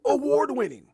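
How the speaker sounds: high booming hype voice, award pushed up; new announcer voice. Shown as a demonstration. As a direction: belt it out like a ring announcer. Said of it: "I don't know where that voice came".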